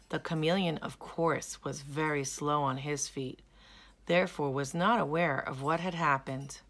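plain reading voice

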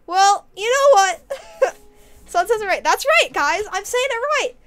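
loud, high, vindicated; gloating outburst with a laugh; triumphant. Real, not acted. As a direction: crow that you were right. Vindicated by someone in chat.

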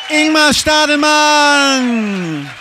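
long, high, loud stretched-out call; announcer shout. Real, not acted. Host calling the comedian's name to the audience with a booming stretched shout. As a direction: boom the name out like a boxing announcer.

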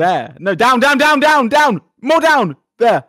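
loud, high, urgent repeated commands; frantic directing. Real, not acted. Player steering partner on the map, frantic. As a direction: yell directions as if time is running out.

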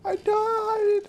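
very high, whiny fake crying; hammed-up tearful cry; mock wail. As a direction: lament it in a squeaky, drawn-out whimper. Laments own death in game.

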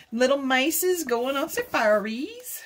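normal talking voice

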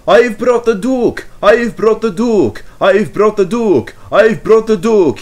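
high, loud triumphant cry over and over; triumphant puffing. Acted. Skarloey arriving with the Duke, triumphantly loud and high. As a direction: crow your news proudly, again and again. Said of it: "he puffed, and triumphantly".

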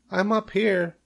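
high little creature voice; tiny voice piping up; small voice. A narrator's reading. Baby spider calls down to Wilbur, small voice. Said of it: "he heard a small voice".

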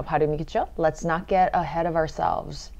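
ordinary talk, nothing marked

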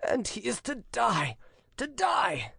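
high-pitched, anguished outcry; despairing cry. A narrator's reading. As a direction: wail it as a despairing father. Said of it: "cried Solomon, striking his forehead in despair".

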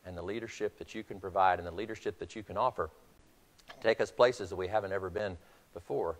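low, soft, earnest coach; hushed encouragement. Retold or imitated. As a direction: lean in close and speak softly to a spent player. Coach leaning down to exhausted player.

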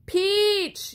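high called-out name; yelled name. A narrator's reading. Friend calling out as Peach flies off, high yell. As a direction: call after her as she falls. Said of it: "yelled Blue".

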